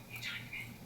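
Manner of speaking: soft whisper; whispered prompt. Retold or imitated. Coach whispers to the student leader, soft whisper. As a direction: whisper to the boy beside you. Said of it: "and I say".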